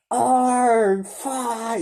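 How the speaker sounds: high, drawn-out mock yell; yelling kid. Reprising the kid from Doctor Phil for laughs, high yell. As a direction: imitate the kid's high cursing outburst. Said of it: "You know, that guy".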